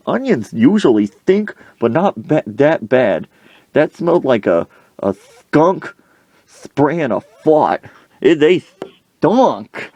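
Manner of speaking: high, emphatic mock rant; indignant rant. Coach complaining about onions, hammed-up indignation.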